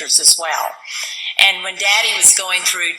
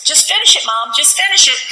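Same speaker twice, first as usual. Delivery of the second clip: higher-pitched imitation of a coaxing daughter; raised voice, retold daughterly coaxing; smiling daughter; 7 semitones higher, 3 dB louder than usual. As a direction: lightly mimic a daughter urging Mom with a smile. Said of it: "looked at me with a smile".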